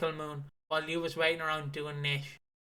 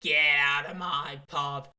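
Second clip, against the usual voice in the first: imitating Phil; 2 semitones lower, 4 dB louder, slower than usual. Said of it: "Like Phil does".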